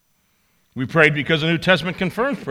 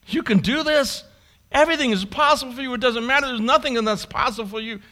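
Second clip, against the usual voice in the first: pleading high; 9 semitones higher than usual. Acted. Jesus urging the Father.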